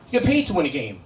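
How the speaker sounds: usual speaking voice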